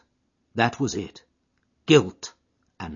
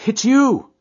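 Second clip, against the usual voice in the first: a sudden high, loud "It's you!" of surprise; startled exclamation; 12 dB louder than usual. A narrator's reading. Jack startled by Etty, loud surprised cry. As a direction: caught off guard, then relieved. Startled man recognises his sweetheart.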